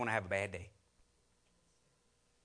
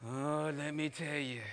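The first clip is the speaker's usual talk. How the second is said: slow, drooping sigh; weary moaning coworker; Debbie Downer; 3 dB quieter, slower than usual. Retold or imitated. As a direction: let each word sag with gloom. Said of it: "How you doing?".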